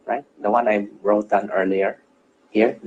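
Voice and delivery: usual speaking voice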